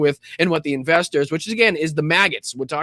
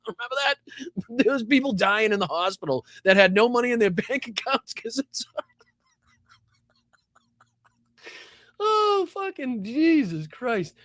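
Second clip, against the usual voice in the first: real laughing fit, pitched up; words giving way to laughter; helpless laughter; 6 semitones higher than usual. Real, not acted. Helpless laughing at donation scam.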